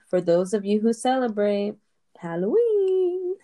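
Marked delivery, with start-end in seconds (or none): 2.2-3.3 high, drawn-out spooky Halloween. Acted. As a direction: stretch it out like a ghost.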